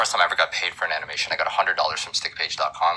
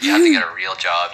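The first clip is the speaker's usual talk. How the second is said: stern grandpa; 13 semitones higher, 5 dB louder than usual. Retold or imitated. Grandpa lecturing about real job.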